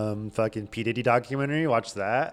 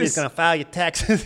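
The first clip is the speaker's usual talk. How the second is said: louder, higher hype-man push, speeding up; hype man taunt; 6 semitones higher, 5 dB louder than usual. Retold or imitated. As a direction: goad him like a hyped-up mogul. Repeat of [name] taunt.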